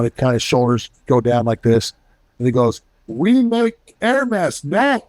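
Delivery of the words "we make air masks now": put-on Saudi accent pitched much higher and slowed right down; a high, slow Saudi-accented pilot joking. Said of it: "In a Saudi voice".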